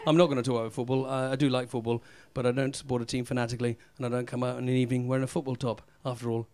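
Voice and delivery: ordinary talk, nothing marked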